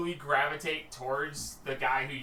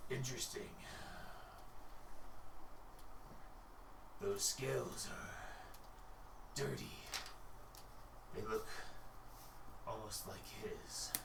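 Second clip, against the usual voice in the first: low threat, almost to himself; menacing murmur; 4 semitones lower, 13 dB quieter than usual. Acted. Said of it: "he goes".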